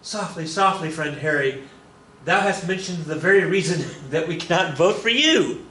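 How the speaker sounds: mock-pious rebuke climbing high at the end; Quaker. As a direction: chide as a Quaker, building to the punchline. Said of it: "he says".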